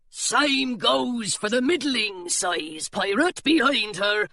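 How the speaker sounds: put-on guard turning away the children; burly pirate. A narrator's reading. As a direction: lay down the rule, pirate style. Pirate continues refusal.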